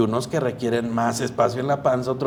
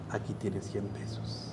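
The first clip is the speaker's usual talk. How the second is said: much softer, slow motherly voice; gentle mother; 4 semitones lower, 14 dB quieter, slower than usual. Retold or imitated. Mother giving Juanito money for the errand, soft and slow. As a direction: hand it over kindly and slowly.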